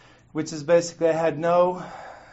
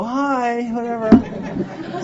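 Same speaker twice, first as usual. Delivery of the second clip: breezy shrug; 8 semitones higher, much slower than usual. Retold or imitated. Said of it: "now they just go".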